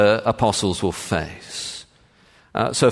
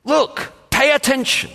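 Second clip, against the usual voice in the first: "Look" called out high, as if straight to the apostles; preacher voicing Jesus' urgent command; urgent call to attention; 13 semitones higher than usual. Shown as a demonstration. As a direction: grab their attention with "Look". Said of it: "to what is a thematic statement".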